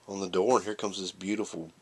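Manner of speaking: ordinary delivery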